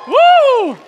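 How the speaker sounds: loud high woo; crowd-pumping woo; excited whoop. Real, not acted. Hyping up the crowd.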